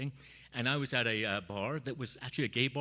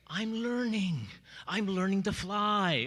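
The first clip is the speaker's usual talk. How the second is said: higher, slow, proud declaration; triumphant cry; 8 semitones higher, 5 dB louder, slower than usual. Retold or imitated. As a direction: announce it like a bird taking off.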